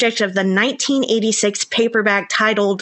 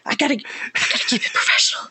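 quick whispered reminder to self; hurried whispered aside; 4 dB quieter, faster than usual. Real, not acted. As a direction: whisper it fast, pulling yourself together. Whispered aside to regain composure.

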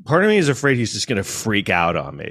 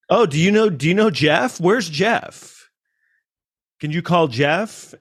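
mock innocent; 6 semitones higher, slower than usual. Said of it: "it was a funny point that I was making".

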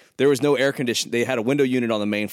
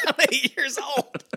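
put-on high kid voice through laughter; joining the child joke, high and laughing; little kid. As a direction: echo the kid bit while cracking up. Echoing kid bit laughing.

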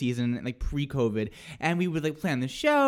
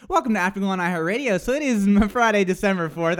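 brisk, louder radio-host welcome; mock show intro; 4 semitones higher, 5 dB louder than usual. Host jokingly reopening Afterglow alone, brisk announcer delivery. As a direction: launch the show with announcer energy.

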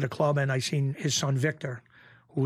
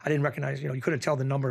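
normal talking voice

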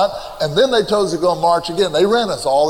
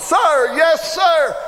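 hollered at the top of lungs; 11 semitones higher, much slower than usual. Retold or imitated. Recruit shouting back at the drill sergeant, full-throated yell. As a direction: bellow it like a recruit. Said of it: "I hollered".

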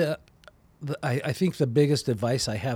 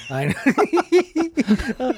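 laughing; 12 semitones higher, 5 dB louder than usual. Real, not acted. Laughing at friend's jab.

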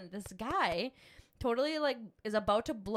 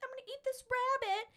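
high, squealing mock glee, gonna eat tonight; mock excited. Mom mimicking eager survivalists on Alone, high excited squeal. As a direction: gush like a starving man spotting dinner. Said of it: "they, like, get so excited".

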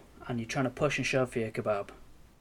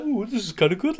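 higher, louder teasing impression of enjoying the ride; mock delighted friend; 8 semitones higher, 5 dB louder than usual. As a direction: poke fun at his innocent joy. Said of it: "I could just imagine you like".

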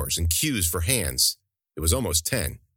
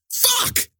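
much higher, louder yelp of pain; pained yell; 4 dB louder than usual. Acted. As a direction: cry out as your nose gets smashed. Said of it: "he yelled".

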